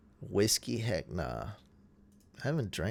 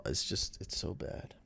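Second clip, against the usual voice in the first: strained whisper; 9 dB quieter than usual. Real, not acted. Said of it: "But my voice".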